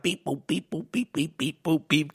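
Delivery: quiet robotic beep boop. Pretend. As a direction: beep like a machine on the phone. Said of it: "it's like".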